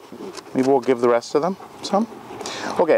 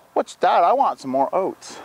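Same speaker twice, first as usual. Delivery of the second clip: lightly voiced curious goat, a bit higher; voicing the goat; 7 semitones higher than usual. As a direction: give the goat a little pitched-up voice. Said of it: "he's just".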